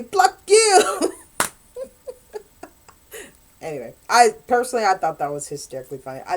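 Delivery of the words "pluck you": high, loud jeering archer's taunt; mocking taunt. Archers taunting the French with a mock jeer. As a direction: sneer it at the enemy, much higher. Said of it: "they would pull it and say".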